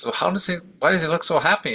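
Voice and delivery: high, eager questions; curious young prince. Retold or imitated. Prince asking the guard about the monk, high eager questions. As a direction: ask with bright curiosity.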